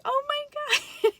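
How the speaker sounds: high, loud mock squeal; retold amazement. Mother told baby is coming now, mock amazed squeal.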